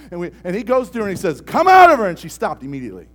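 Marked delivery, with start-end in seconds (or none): 1.4-2.1 much higher, loud rebuke; commanding shout. Retold or imitated. Senior pastor orders the demon out, loud command. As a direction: cast it out with full authority.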